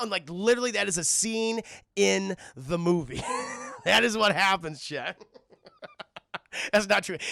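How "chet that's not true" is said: ordinary talk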